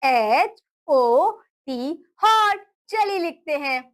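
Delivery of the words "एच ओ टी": slow spelling out. Shown as a demonstration. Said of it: "हॉट की spelling क्या होती है?".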